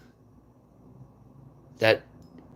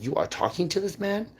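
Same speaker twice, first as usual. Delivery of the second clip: incredulous; 9 semitones higher than usual. Retold or imitated. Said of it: "And I'm like".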